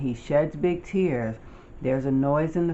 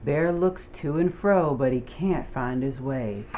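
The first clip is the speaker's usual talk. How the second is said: plain reading voice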